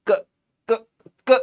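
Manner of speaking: "क" cut very short, three separate tries; attempted bare consonant. Shown as a demonstration. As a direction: try "क" with almost no vowel after it. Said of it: "केवल इसका उच्चारण कर सकते हो". ("क" को बिना स्वर के, झटके से बोलने की कोशिश)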